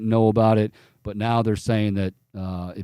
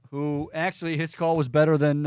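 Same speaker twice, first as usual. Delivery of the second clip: usual speaking voice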